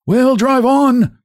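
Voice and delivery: very high, slow cracked order; bossy hunchback with a cracked, high voice. A narrator's reading. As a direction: order the driver on, high and drawn out. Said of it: "in his cracked voice".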